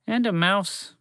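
ordinary delivery, nothing marked

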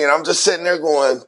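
ordinary talk

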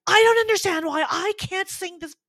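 hammed-up high, loud panicked wail; retold panic. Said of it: "I start to panic".

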